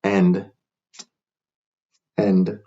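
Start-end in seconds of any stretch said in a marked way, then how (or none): none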